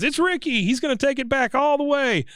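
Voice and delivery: high, excited spectator call; thrilled, high-pitched sideline voice; Hank. Retold or imitated. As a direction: imitate a fan calling the play as it happens. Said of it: "Hank says".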